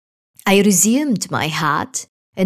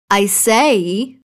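firmly raised voice; louder; 2 semitones higher, 3 dB louder than usual. A narrator's reading. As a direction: speak up a bit louder. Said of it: "I said on a rather louder note".

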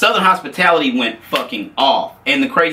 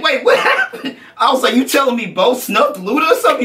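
incredulous; 10 semitones higher than usual. Retold or imitated. Incredulous retelling, laughing.

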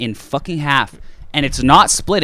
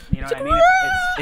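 cracking squeak; faster than usual. Retold or imitated. Painful poop story, voice cracks.

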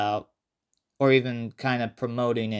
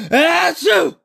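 mock sneeze; 7 dB louder than usual. Mimicked sneeze in story.

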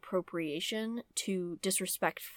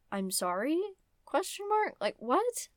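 mock bafflement; 9 semitones higher than usual. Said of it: "baffled mock reply".

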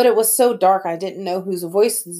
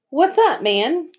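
put-on cool kid voice; 9 semitones higher, slower than usual. Miles greets the bullies.

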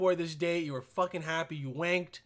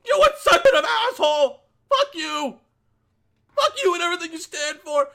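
much higher, much louder screaming insults; furious outburst; 10 semitones higher, 10 dB louder than usual. Retold or imitated. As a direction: shriek at him in outrage, half laughing. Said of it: "and be like".

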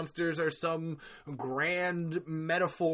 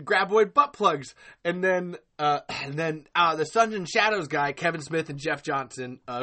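ordinary talk, nothing marked

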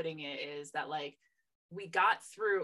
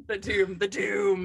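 dramatic doom; 10 dB louder than usual. Pretend.

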